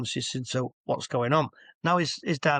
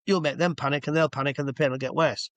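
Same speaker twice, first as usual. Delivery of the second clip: normal talking voice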